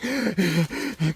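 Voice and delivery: imitated breathless gasps; mock gasping for air. Hiker drinking water on a steep hill, mock gasping. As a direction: gasp as if winded after a swallow. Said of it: "'cause you stop breathing".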